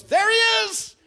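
high, full-force battlefield shout; commander's shout. Acted. Captain spotting the king and calling his archers, shouted. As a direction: bellow the order across the ranks.

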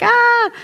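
high, loud cartoon "ah!"; startled yelp. Frog in the pot notices the heat, startled yelp. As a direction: yelp like a suddenly scalded frog. Frog reacting to boiling water.

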